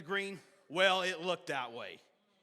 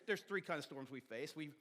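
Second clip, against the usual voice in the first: ordinary delivery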